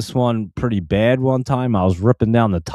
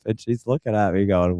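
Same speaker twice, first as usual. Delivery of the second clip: plain reading voice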